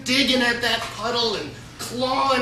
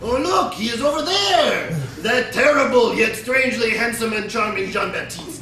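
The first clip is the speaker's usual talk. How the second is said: put-on foxy accent; 3 semitones higher, 4 dB louder than usual. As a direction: play a sneaky fox pointing the bears away. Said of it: "in his best foxy accent".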